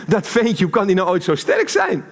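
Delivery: Delila amazed. Retold or imitated. Preacher imitates Delila doubting Samson, mock amazed. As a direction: mimic her disbelief at the little guy. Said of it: "ze denkt".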